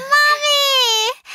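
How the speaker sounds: put-on high childish "waah" sob; tearful wail. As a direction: burst out crying on finding Mum. Child reunited with mother.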